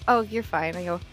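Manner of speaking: ordinary talk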